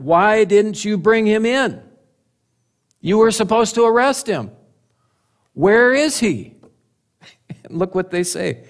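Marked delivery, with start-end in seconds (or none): 0.0-6.6 mock an indignant authority, pitch raised; demanding. Priests demand answers from the returning guards, indignant. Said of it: "leading priests and Pharisees demanded".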